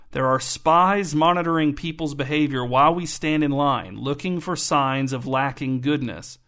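ordinary delivery, nothing marked

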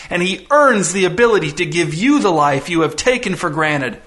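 high-pitched, swooping theatrical tirade; giddy, near ecstatic. Acted. Visitor berates girl over what her father sacrifices, ecstatic. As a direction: pile up the list with gloating, rising fervor. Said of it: "rapidly closing in on ecstatic".

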